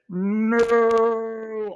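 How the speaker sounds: high, stretched-out slow-motion no; a long high horrified no; drawn-out no. Retold or imitated. [name] reliving the moment everything stopped, stretched-out horrified no.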